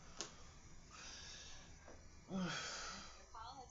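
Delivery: long weary sigh; exhausted sigh before resting; heavy sigh. Real, not acted. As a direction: breathe out tired. Tired, feeling bad.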